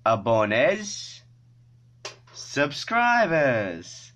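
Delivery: very slow, drawn-out language lesson; say-it-with-me teaching. Shown as a demonstration. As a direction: stretch each word for viewers to repeat. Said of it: "say it with me".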